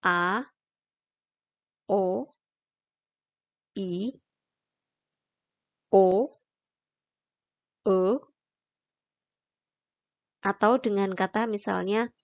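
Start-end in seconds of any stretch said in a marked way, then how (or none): 0.1-8.2 each of "A, o, i, o, e" gliding upward; "A, o, i, o, e" one by one, each like a short question; second tone. Shown as a demonstration. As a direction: let each vowel of "A, o, i, o, e" rise at the end. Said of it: "Jadi naiknya sedikit aja".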